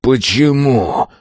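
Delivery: high, slow wail of rage; anguished howl. A narrator's reading. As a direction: howl it in pain. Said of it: "взвыл он".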